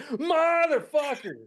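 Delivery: high, drawn-out shouted curse; startled shout. Acted. Viewer acting out getting caught by a jump scare, startled shout. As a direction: yell it out long and high, as if jumped.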